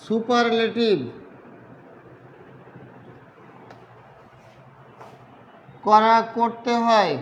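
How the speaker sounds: slow, word by word with long pauses; slow dictation. Real, not acted. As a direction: say it piecemeal as you write it out. Writing out the definition while saying it. (লিখতে লিখতে ধীরে ধীরে থেমে থেমে বলা)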